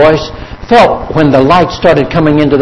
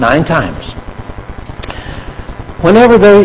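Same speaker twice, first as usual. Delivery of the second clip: ordinary delivery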